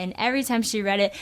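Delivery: usual speaking voice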